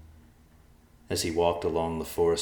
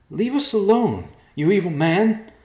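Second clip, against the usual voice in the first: high, loud, slow scream; yelling; 11 dB louder than usual. A narrator's reading. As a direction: scream at the attacker. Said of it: "Yolana yelled".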